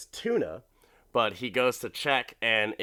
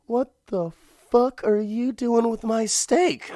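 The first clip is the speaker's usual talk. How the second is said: much higher and louder, voicing a stunned husband; mock outrage, pitched way up; outraged husband; 12 semitones higher, 4 dB louder than usual. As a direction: squeal his disbelief at the dog. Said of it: "And he's looking at the dog like".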